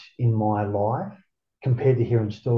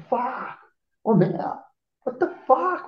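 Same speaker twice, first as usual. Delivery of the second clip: much higher, amazed at being free; retold elated disbelief; 13 semitones higher, slower than usual. Freed man stopping five minutes into the walk, elated disbelief. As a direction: relive the moment it sinks in.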